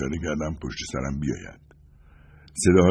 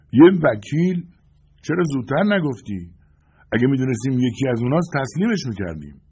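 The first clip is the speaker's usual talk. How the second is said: high, exclaiming character voice; surprised; 7 semitones higher, 4 dB louder than usual. A narrator's reading. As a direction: react in surprise, pitch jumping up. Voice from barricade reacting to revelation. (با تعجب و صدای زیر)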